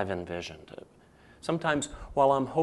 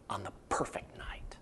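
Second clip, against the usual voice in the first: hushed whisper to close the thought; 3 semitones lower, 11 dB quieter than usual. Real, not acted. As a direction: breathe it out as a secret. Dramatic close of a promise.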